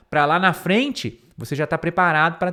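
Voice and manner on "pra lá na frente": normal talking voice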